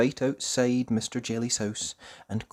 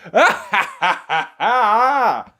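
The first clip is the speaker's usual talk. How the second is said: loud hearty ha-ha laughter; laughing; 9 semitones higher, 12 dB louder, much slower than usual. Acted. Farmer Fields laughing heartily at the mix-up. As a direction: belly laugh. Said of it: "Farmer Fields laughed".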